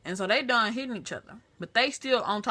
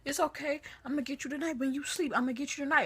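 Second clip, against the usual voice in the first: mock threat; 6 semitones higher, faster than usual. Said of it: "She was like".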